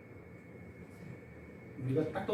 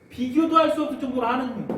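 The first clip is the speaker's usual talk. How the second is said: ordinary talk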